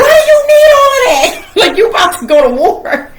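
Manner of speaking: high, loud disbelieving cry, laughing; shocked laughing outcry. Retold or imitated. Sister crying out at her brother loaded with guns, laughing. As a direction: shriek at him in half-laughing disbelief.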